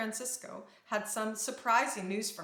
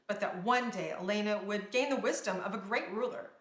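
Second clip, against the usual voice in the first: plain reading voice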